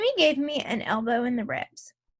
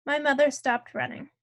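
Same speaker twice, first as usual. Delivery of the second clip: usual speaking voice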